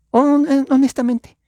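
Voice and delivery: high and loud, honestly incredulous; exasperated outburst. Real, not acted. Preacher pleading honestly with Mexican listeners, high exasperated cry. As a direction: squeeze it out in disbelief.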